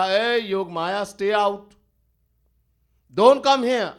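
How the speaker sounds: loud, slow firm command to stay out; commanding order. Retold or imitated. Bhagwan orders Yog Maya away.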